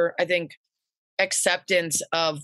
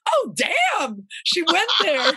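shocked exclamation; 9 semitones higher, slower than usual. Retold or imitated. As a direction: gasp it out in disbelief. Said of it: "we both were like".

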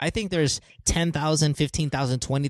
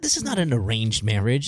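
usual speaking voice

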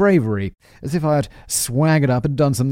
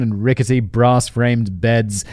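ordinary talk

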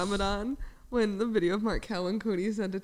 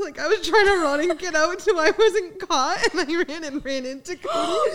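higher-pitched, slowed by laughter; laughing while talking; 8 semitones higher, 4 dB louder, slower than usual. Real, not acted. Housemate recalls fleeing and running into Cody, laughing. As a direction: tell it through real giggles.

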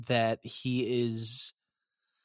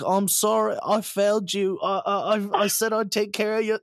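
high, louder pleading apology; mock tearful Alfred; 9 semitones higher, 4 dB louder than usual. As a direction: play the old butler near tears, pitched up and louder. Retelling Alfred's sad scene.

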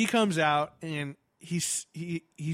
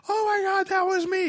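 high-pitched excited squeal; excited fan; 4 dB louder than usual. Retold or imitated. Convention fan realizing he met the star, high excited outburst. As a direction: exclaim it like a thrilled, starstruck fan. Acted fan in crowd exclaiming.

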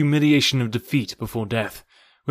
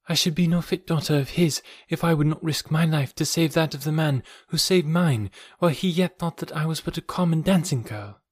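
put-on higher, gentle young woman's voice; man doing a woman's voice; 6 semitones higher than usual. A narrator's reading. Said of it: "she answered".

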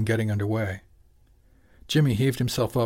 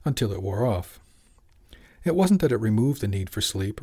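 ordinary delivery

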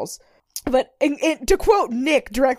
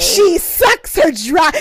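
slow, higher, louder emphasis; emphatic repeat; 7 semitones higher, 9 dB louder, much slower than usual. Real, not acted. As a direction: lean hard on every word. Gleeful repeat of line.

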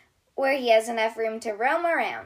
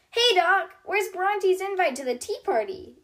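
teasing little brother voice, lightly higher; joking Donnie; 8 semitones higher than usual. A narrator's reading. Little brother teasing Doc about the tea party. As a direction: poke fun at your sister. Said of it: "jokes Donnie".

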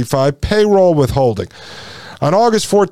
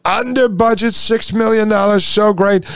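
higher, boastful rally voice with a stretched "Folks"; Trump impression; 5 semitones higher than usual. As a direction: brag like Trump at a rally, pitched up. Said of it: "Trump must have been alive back then".

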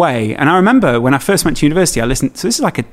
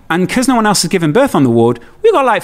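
ordinary talk, nothing marked

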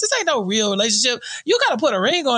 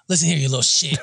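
low menacing quote; 9 semitones lower, faster than usual. Retold or imitated. Quoting Mike's threat.

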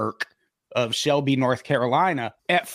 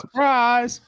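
mock reveal; 9 semitones higher, 3 dB louder than usual. Mock reveal after soda story.

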